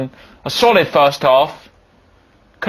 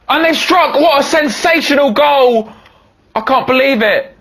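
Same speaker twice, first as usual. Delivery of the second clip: high, loud stunned exclamation; shocked disbelief; 10 semitones higher, 3 dB louder than usual. Acted. Commentator reacting to the opponents' thunderbolt, shocked and loud. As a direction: sound floored by the strike.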